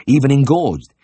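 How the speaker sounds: ordinary delivery, nothing marked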